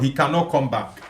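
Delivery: usual speaking voice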